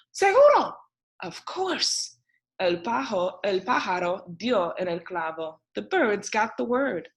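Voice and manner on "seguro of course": eager agreement. A narrator's reading.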